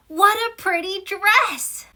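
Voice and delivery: high, slow gush of delight; delighted exclamation. Shown as a demonstration. Teacher showing an exclamation to students, high and thrilled. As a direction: gasp it out as if the dress is gorgeous. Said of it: "They always end with an exclamation point".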